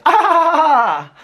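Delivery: loud, high-pitched mock laugh; fake laugh back. Alex pausing the show to answer, mock laugh. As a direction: throw back an exaggerated fake cackle. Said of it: "pauses the show and he goes".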